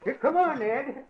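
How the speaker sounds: high, loud call to the dogs. Acted. Groundskeeper leading the dogs to the house, loud call. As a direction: call them in across the yard.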